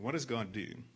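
usual speaking voice

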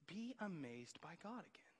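soft unvoiced whisper, slowed; hushed whisper. Real, not acted. Pastor whispering to his church in awe. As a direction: breathe it to the congregation. Pastor urging wonder softly.

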